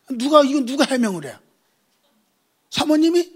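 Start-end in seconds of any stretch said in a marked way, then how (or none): 2.7-3.3 high, loud disbelieving question; mock incredulous question. Preacher teasing congregation, high incredulous question. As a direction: act shocked at the idea.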